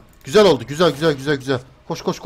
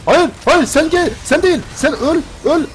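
frantic shouting; 10 semitones higher, 6 dB louder than usual. Real, not acted. Frantic fight in the game.

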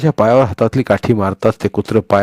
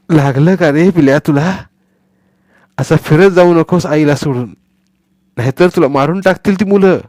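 put-on higher, louder boy's voice cooing to a pup; tender boy; 7 semitones higher, 4 dB louder than usual. Boy Sonya comforting the injured puppy, tender.

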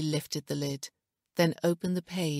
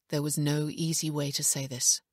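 ordinary delivery

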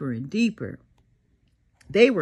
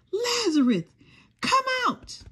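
mighty yell; 12 semitones higher than usual. Acted. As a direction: call it out high, like a lion's roar. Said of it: "he yelled like a m-, lion's mighty roar".

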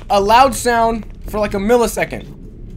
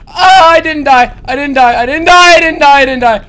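relieved shout; 3 semitones higher, 9 dB louder than usual. Real, not acted. Survives monster attack, relieved.

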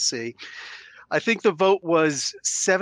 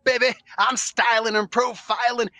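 Ric Flair; 5 semitones higher than usual. Acted. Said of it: "Ric Flair comes in".

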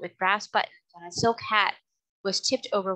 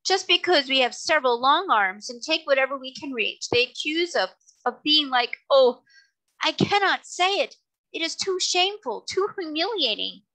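high, acted wounded wailing; tearful lament; 7 semitones higher, 4 dB louder than usual. A narrator's reading. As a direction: complain mournfully, rising into a wail. Sobbing octopus continues his lament.

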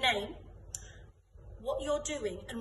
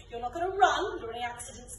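usual speaking voice

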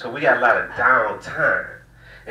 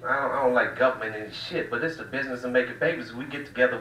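ordinary talk

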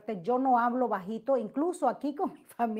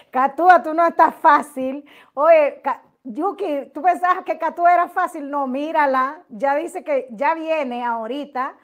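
teasing call-out; 4 semitones higher, 11 dB louder than usual. Real, not acted. Teasing a viewer by name.

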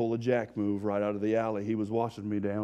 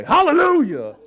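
much higher, loud praise shout; joyful hallelujah; 18 dB louder than usual. Shown as a demonstration. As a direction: belt out praise to the rafters. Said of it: "We ought to say".

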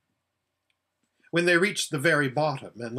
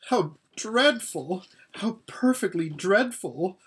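whimpering; 6 semitones higher than usual. Acted. Lasaraleen whimpers in fear while hiding in the room. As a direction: whimper it like a scared girl hiding. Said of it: "whimpered Lasaraleen".

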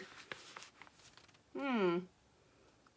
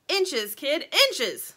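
mildly snapped correction, raised at first; snappy; 6 semitones higher, 7 dB louder, much slower than usual. A narrator's reading. Sugar correcting the little bird, mildly snappy. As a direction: correct the kid with a touch of impatience. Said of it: "snapped Sugar".

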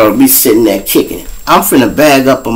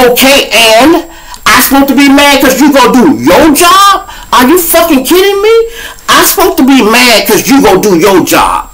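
incredulous retort; 12 semitones higher than usual. Retold or imitated. Answering the angry cop, high and scoffing. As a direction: throw it back at the cop mockingly.

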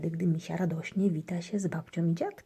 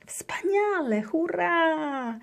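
high joyful cheer; high happy hurrah; 11 semitones higher, 4 dB louder, much slower than usual. A narrator's reading. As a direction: whoop with joy. Girl cheering at return.

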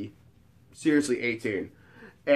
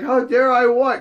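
high, louder baffled retort; mock indignant disbelief; 9 semitones higher, 5 dB louder than usual. Man answering offended woman, mock bewilderment. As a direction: throw her outrage back, bewildered.

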